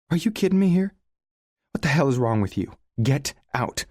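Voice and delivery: high, loud exasperated snap; snapping in temper. A narrator's reading. Sarah losing her temper at Leo, high exasperated snap. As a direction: let her temper crack through. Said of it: "she snaps right alongside her temper".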